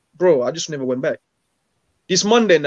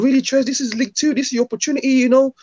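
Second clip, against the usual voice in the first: higher-pitched mock disbelief from the boss; incredulous manager; 7 semitones higher than usual. Manager scolding player who wants to leave, higher incredulous voice. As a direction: play the boss, surprised he wants out.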